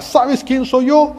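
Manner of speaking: high, loud and slow challenge; soldier shouting. Acted. Roman soldier shouting back at Mary Magdalene, loud. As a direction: shout it down at her. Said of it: "el soldado le grita".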